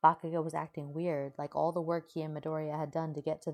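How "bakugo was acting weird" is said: ordinary delivery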